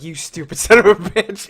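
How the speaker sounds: teasing insult through a laugh; mock insult. Mom teasing the driver, mock insult through laughter. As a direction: jokingly taunt her. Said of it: "She's like".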